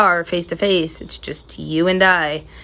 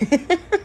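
high rising fake giggle; fake laugh; 11 semitones higher, 3 dB quieter than usual. Shown as a demonstration. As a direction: laugh just loud enough to be believed. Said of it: "laugh loud enough for someone to believe".

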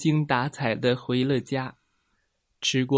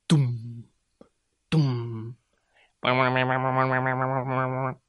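voiced alien-landing noises; 3 semitones lower than usual. A narrator's reading. Narrator voices the alien's sudden appearance, ominous low sound effects. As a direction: imitate a spaceship landing with your voice.

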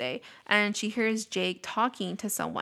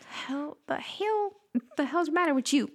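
mock freaked-out shout; 6 semitones higher than usual. Teasing the babysitter's reaction, in a hammed-up high voice. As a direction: ham up the babysitter's shock.

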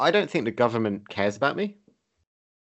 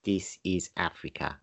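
African accent imitation; 4 semitones lower, 8 dB quieter than usual. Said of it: "it's not great".

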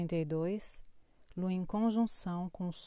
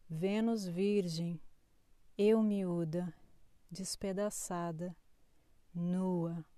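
poetry reading; 3 dB quieter than usual. Acted. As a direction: read it like a spoken poem. Reads a poetic horoscope.

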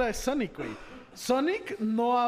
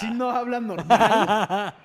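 louder, laughing through the words; laughing disbelief; 9 dB louder, much slower than usual. Real, not acted. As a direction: protest while cracking up. Laughing protest.

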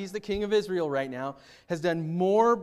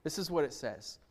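normal talking voice

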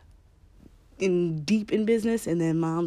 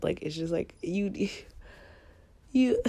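usual speaking voice

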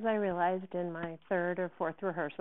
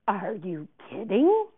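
slow, rising, louder disbelief; incredulous anger; 2 semitones higher, 9 dB louder, slower than usual. Acted. Director to a student backing out, incredulous. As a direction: draw it out into an outraged question.

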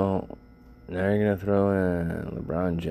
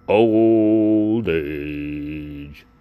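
louder, stretched-out lament; louder drawn-out lament; drawn-out groan; 6 dB louder than usual. Real, not acted. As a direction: moan the words out long. Repeats the complaint drawn out.

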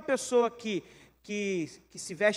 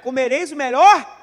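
slow, much louder scripture quote; emphatic quote; 11 dB louder than usual. Acted. Preacher to congregation: loud, slow scripture quote. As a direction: lean hard on melhor.

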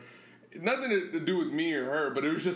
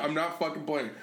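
usual speaking voice

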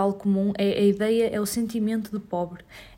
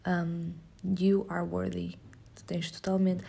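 usual speaking voice

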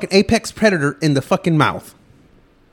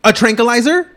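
incredulous; 7 semitones higher, 3 dB louder than usual. Real, not acted. Incredulous repeat, higher.

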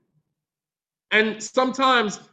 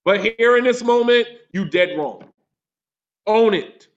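forceful preaching; 3 semitones higher, 4 dB louder than usual. Acted. Preacher presses the point.

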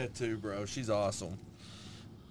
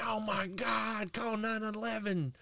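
mock injured whine; 6 semitones higher than usual. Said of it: "been like".

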